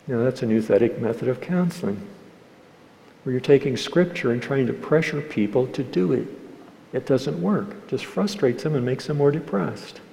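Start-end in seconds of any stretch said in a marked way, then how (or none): none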